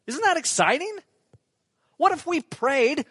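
high, loud, thrilled question; excited. Real, not acted. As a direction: lift it with real excitement. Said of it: "that's exciting to me".

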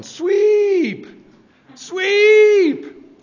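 loud, high, drawn-out yell; yelling curling skip. Retold or imitated. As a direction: bellow it like a skip calling down the ice. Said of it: "he's yelling".

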